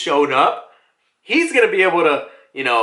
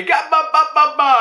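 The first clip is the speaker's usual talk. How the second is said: high, choppy "ga ba ba" laugh; high mock belly laugh; gleeful giant laugh; 11 semitones higher than usual. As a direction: chant a cartoon guffaw, having a great time. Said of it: "just, like, having a fun time".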